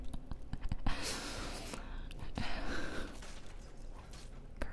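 long breathy giggle without voice; hushed laughing fit; whispered laughter. Real, not acted. Laughing at squishy new character.

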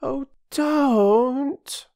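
put-on high, loud wail of a little girl; plaintive high-pitched plea; despairing. A narrator's reading. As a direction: draw it out in childish despair. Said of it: "said Phyllis in despair".

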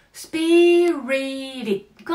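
"スピリティッド" slow and loud, t tapped like ら; flapped t. Shown as a demonstration. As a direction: model "スピリティッド" slowly, the t a light tap. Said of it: "いくよ、せーの".

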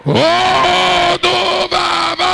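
high, slow, sustained cries; prayer shout. Real, not acted. Prayer leader calling out the idol's name, slow high shouts. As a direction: hold every vowel long on one high note.

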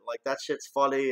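ordinary talk, nothing marked

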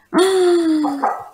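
high, long gasp; delighted gasp. Real, not acted. Player spotting a fluffy cat, delighted gasp. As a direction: gasp in awe.

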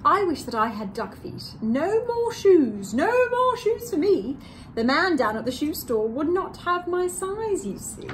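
drawn-out playful read to little listeners; reading to children. A narrator's reading. Co-host reading her turn of the story to children. Reads book aloud.

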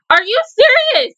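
can't believe what the show just did; stunned disbelief. Real, not acted. Viewer reacting to a cliffhanger ending, shocked and high. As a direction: pitch jumps way up in disbelief.

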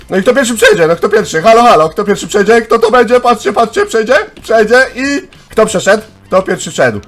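high, loud, urgent finish-line commentary; breathless-with-suspense commentator; excited live call. Real, not acted. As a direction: call the race as it peaks.

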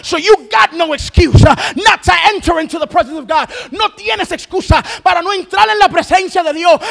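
loud bilingual preaching, pushing hard; fiery revival preacher calling people into God's presence; full-force preaching. Acted. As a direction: preach it out to the congregation.

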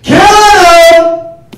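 high, loud, stretched-out yell; long holler. Pretend. Comic hypes the crowd, long high holler. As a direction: belt out one word and hold it.

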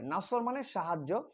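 ordinary delivery

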